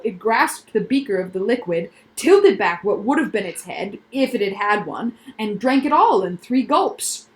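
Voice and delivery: ordinary delivery, nothing marked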